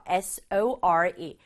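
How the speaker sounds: S O R E spelled out slowly, letter by letter; spelling out letters. Shown as a demonstration. Teacher spells sore letter by letter for kids. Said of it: "sore 보이죠".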